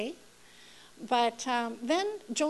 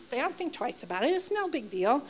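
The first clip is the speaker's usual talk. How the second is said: ordinary delivery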